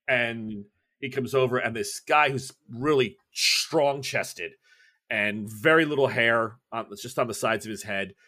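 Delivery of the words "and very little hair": ordinary talk, nothing marked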